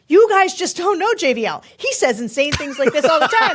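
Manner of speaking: as if waving off the critics; mock exasperated defense. Host imagining defending her cohost to them, mock exasperated. Telling No Labels to ignore JVL.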